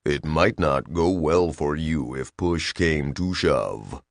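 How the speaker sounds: low, slow, menacing machine voice; sinister. A narrator's reading. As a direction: let the threat creep out slowly. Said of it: "its intonation definitely sinister".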